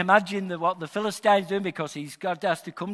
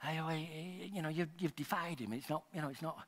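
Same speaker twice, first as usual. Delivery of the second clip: mock timid, much softer mumbling; timid mumble; 4 semitones lower, 17 dB quieter than usual. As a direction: whisper it like a boy too scared to confront. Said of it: "He's not going".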